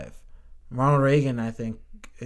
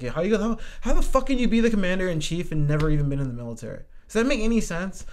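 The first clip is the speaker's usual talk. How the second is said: real exasperated disbelief, pitched up; incredulous; 7 semitones higher, faster than usual. Real, not acted. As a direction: demand an answer, higher and incredulous. Incredulous rant about unqualified presidents.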